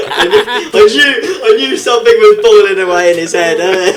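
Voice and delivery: high, loud laughter through the words; laughing. Real, not acted. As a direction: crack up while teasing him. Laughing at quiet guest's comeback.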